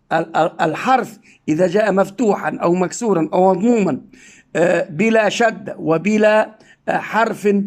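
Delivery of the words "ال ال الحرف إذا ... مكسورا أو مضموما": ordinary delivery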